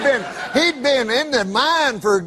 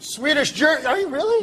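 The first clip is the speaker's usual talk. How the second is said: mock surprise; 5 semitones higher than usual.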